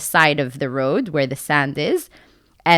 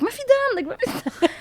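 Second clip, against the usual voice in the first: very high, softer retold insistence; high denial; 5 dB quieter than usual. Crash victim telling police there's no blood, high denial. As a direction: deny it in a squeaky high voice.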